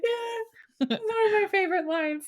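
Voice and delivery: high, laughing through the words; cracking up while talking; giggling almost to tears. Real, not acted. Laughing at favorite line.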